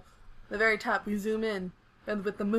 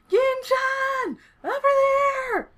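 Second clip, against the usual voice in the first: very high, drawn-out scream; 7 dB louder, much slower than usual. Acted. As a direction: call out at full pitch across the rooftops.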